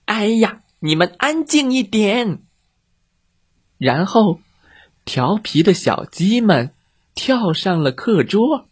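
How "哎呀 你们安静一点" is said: higher, exasperated cat-teacher cry; shrieking cat teacher. Acted. Cat teacher covering her ears, shrieking at chicks. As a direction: squeal at the kids to hush. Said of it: "尖叫道".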